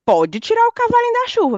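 high teasing sing-song; mock sing-song. Teasing her husband with a Brazilian saying, high and playful.

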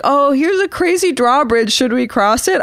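airheaded thrill-seekers; mock naive hikers. Hikers eye the drawbridge, mock eager. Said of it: "They're like".

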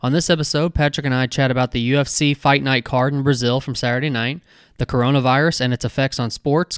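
bright host promo over music; podcast intro read. Acted. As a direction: sell the show like a radio host.

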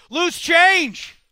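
loud, high-pitched sudden call; game announcer shout. Pretend. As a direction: yell it to startle the guest. Springs next game on guest.

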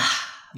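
short calming sigh; soft, airy exhale; demonstrated breath. Shown as a demonstration. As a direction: take a breath and let it go. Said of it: "just take a breath".

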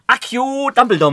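high, very loud, stretched incantation; mock spell-casting. Host pretends to summon a second guest, mock spell-casting. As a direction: shout it like a pretend wizard.

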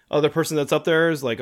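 usual speaking voice

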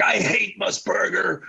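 mock-hostile fan outburst, drawn out; acted fan grievance. Acting out hostile fans' complaint.

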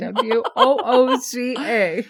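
slow spelled letters dissolving into laughter; spelling it out laughing. Shown as a demonstration. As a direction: spell it out slowly, cracking up. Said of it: "That's what my text said".

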